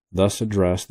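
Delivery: plain reading voice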